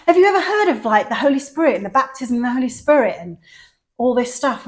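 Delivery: high, loud and eager; enthusiastic. Retold or imitated. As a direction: gush it like an excited evangelist. Voicing the enthusiastic charismatic woman.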